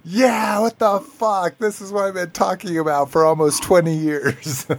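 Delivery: high, mock-outraged vindication; exasperated wife. Imagined wife editing the show, agreeing in exasperation. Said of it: "she would fucking be like".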